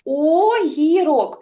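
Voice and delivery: slow, drawn-out Огірок with lips rounded; drawn-out word. Shown as a demonstration. Teacher modelling the word to little kids, slowly drawn out. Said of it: "Подивись на мої губки".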